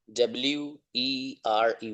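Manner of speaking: slow, letter by letter spelling; slowly spelling it out. Shown as a demonstration. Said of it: "इसका pronunciation".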